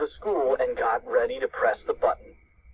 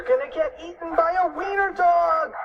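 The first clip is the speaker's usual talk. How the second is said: high-pitched cartoon panic; panicked cry; 3 dB louder than usual. A narrator's reading. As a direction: shout it high, in mock terror. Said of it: "cried Cheeseball".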